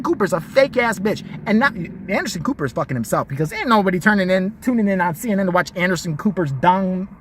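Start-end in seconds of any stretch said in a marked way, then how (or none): none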